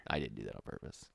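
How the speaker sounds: low, soft confession; quiet admission. Real, not acted. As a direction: drop the voice to admit it. Quietly admits pun was accidental.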